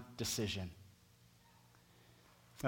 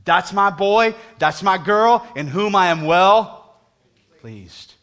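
booming voice of God; 6 semitones higher, 12 dB louder, slower than usual. Retold or imitated. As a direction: proclaim God's blessing over the church. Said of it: "guess what God says about you".

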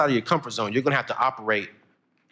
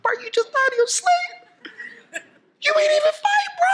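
much higher, laughing through a fake mournful plea; mock emotional lament. Student mourning his boiled frog, mock tearful and laughing. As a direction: ham up grief over a dead frog. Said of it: "I'm sitting there getting emotional".

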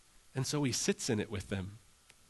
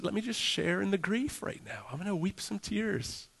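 close, shared grief; 9 semitones higher than usual. Acted. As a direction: gently offer to share someone's sorrow. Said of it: "he comes in close".

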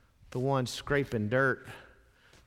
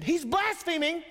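much higher, scandalized "He's blaspheming"; accusing scribe; 14 semitones higher than usual. Retold or imitated. Preacher voicing the scribe accusing Jesus, scandalized high pitch. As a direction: voice a shocked, self-righteous scribe. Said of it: "he said".

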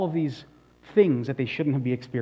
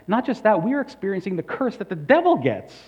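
indignant accusation; 7 semitones higher, 5 dB louder than usual. Acted. Israel voiced accusing God.

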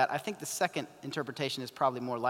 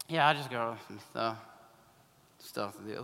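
mumbling excuse; 7 semitones lower, 6 dB quieter than usual. Retold or imitated. College student dodging a Bible study invite, mumbling. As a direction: lower and softer, words trailing off. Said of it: "they're mumbling".

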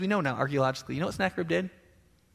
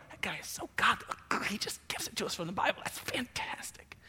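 awed churchgoers in a soft whisper; hushed admiring whisper; 3 semitones higher, 8 dB quieter than usual. Retold or imitated. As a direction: breathe it out like a starstruck fan. Congregants praising the preacher.

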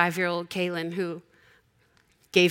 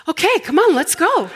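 put-on cheery little girl; 11 semitones higher, 7 dB louder than usual. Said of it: "she was like".

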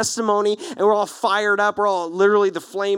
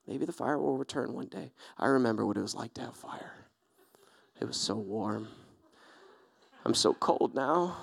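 softer, lower, dragging lament; mock mournful; 8 semitones lower, 11 dB quieter than usual. Pitiful camper mourning his fire to a neighbour, mock mournful. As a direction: sigh it out like a lost soul.

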